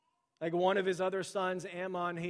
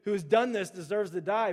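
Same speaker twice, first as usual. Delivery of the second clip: louder, indignant repeat; self-righteous anger; 3 semitones higher, 7 dB louder than usual. Retold or imitated. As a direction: spit out the self-righteous verdict. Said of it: "he's just getting angry".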